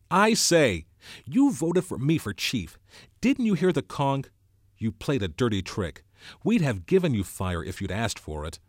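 loud, high angry outburst that eases toward the end; temper blazing. A narrator's reading. Ralph confronting Jack over the fire, angry outburst. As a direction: let the anger burst out, then press the accusation. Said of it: "Ralph's temper blazed out".

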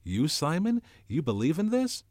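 high, loud, incredulous question; astonished, pitched well up; amazed. A narrator's reading. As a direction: ask in rising disbelief. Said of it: "Ralph stood up in amazement".

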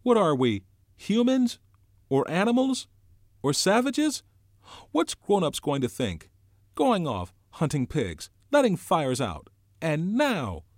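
very high, loud, indignant questions; shrill, scolding outburst, pitch shooting up. A narrator's reading. Piggy berates the assembled boys, shrill and indignant. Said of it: "Piggy's voice shrilled".

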